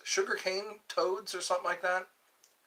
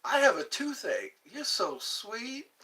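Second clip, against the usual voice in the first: swooning; 8 semitones higher than usual. Pretend. Pickup line, high mock.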